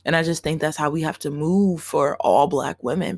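ordinary talk

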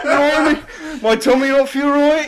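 high, loud laugh-talk; squeaking through laughter; laughing hysterically. Real, not acted.

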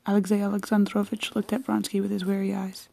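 usual speaking voice

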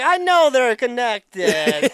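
drawn out, too sure of yourself; mock know-it-all. Mock smug self-quote.

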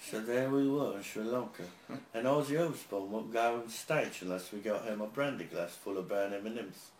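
put-on British-accented roadie telling a tall tour tale; put-on UK accent, old roadie spinning a yarn. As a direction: quote an old UK roadie's rambling war story. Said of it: "That's how he talks".

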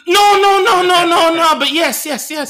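very high, loud rush of protests; hammed-up panicked yelping, easing at the end; frantic backpedalling. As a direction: squeal denials, then cave in. Acting Alma's frantic denial, then admission.

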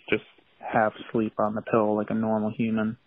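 ordinary talk, nothing marked

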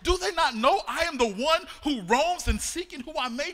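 loud, rising outrage as the devil; the devil scoffing at the church, in loud mock fury; devil's indignant rant. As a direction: rail at the church like an offended devil. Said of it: "And he says".

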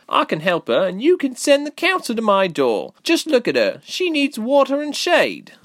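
put-on high, loud, insistent girl; defiant girl. A narrator's reading. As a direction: stand up to the crowd, pitched very high. Girl defends Ilse to villagers.

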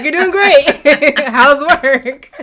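high, slow and loud, laughing through the words; laughing mock praise. Teasing her family's forced small talk while laughing. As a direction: imitate the relatives' sugary praise, cracking up.

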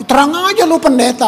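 sarcastic retort. Retold or imitated. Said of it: "Coba saya yang jawab".

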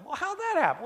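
high, incredulous asking voice; a hint of mock disbelief; mock puzzled question. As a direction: play the puzzled skeptic. Mock dialogue with skeptic.

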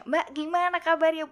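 high, loud neighborly greeting, imitated; basa-basi Bu RT. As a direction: greet her like the chatty neighbor lady. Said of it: "biasa basa basi kan". (menirukan sapaan basa-basi ibu tetangga)

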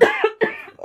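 sudden choking cough; coughing fit, choking. Real, not acted. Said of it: "I really had a choking fit".

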